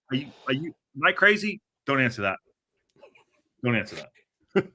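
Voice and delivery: normal talking voice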